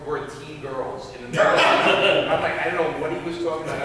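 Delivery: ordinary delivery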